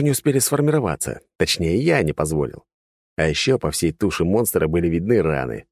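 usual speaking voice

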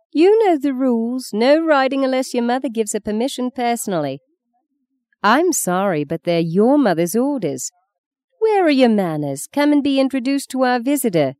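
put-on firm, high voice correcting the children; strict grandmother. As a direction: scold the grandchildren as their grandmother.

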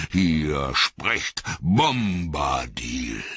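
slow, high, ringing commands; commanding old Tom. Acted. Tom Bombadil banishing the willow to sleep, slow ringing commands. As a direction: pronounce each order like a spell.

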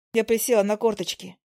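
ordinary delivery, nothing marked

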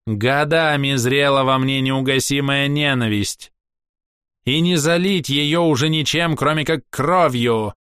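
high, proud ringing voice; a scornful elf lecturing strangers; haughty elf. A narrator's reading. Castiel continues.

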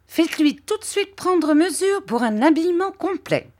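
put-on high, eager simple-hearted old man; light up with a bright idea; high beaming voice of a gentle old man; beaming Mr Dick. A narrator's reading. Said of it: "prenant un air radieux".

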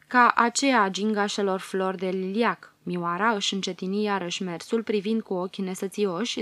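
ordinary delivery, nothing marked

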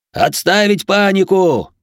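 high, slow, loud bellow; drawn-out commanding shout; roared order. A narrator's reading. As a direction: roar it over a panicking crowd. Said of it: "взревел Герман".

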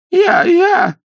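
high, loud braying donkey cry; hoarse donkey cry. A narrator's reading. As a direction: bray it out like a desperate donkey. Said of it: "хрипло кричал Пиноккио". (хриплый ослиный крик)

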